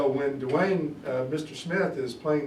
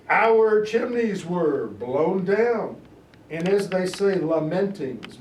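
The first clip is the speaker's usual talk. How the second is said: slow, louder and higher Shakespeare recital; dramatic reading; 5 semitones higher, 7 dB louder, slower than usual. Acted. Teacher performs Lennox's speech for the class with dramatic weight. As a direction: declaim it like a stage actor.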